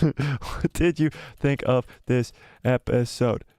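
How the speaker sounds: each word spaced out slowly while typing; slow typing dictation. Real, not acted. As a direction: read it slowly as you type.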